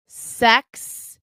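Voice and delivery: ordinary talk, nothing marked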